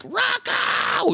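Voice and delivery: high, strained mock yell; imitated shout. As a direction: screech the name as if calling him out. Acting out a film clip's shout.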